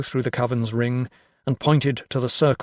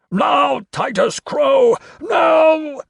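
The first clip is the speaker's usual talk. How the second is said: high, loud, urgent shout; crying out; 13 semitones higher, 6 dB louder, much slower than usual. A narrator's reading. Carstairs demands Crow at the climax, crying out. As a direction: shriek the demand like a crazed sorcerer. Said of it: "cried Carstairs, his voice a glutinous gabble".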